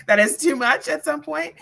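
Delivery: high, loud laugh running into the words. Real, not acted. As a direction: talk through a burst of laughter. Laughs while making her point.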